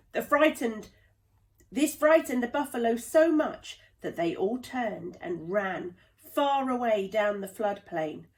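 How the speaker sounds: ordinary delivery, nothing marked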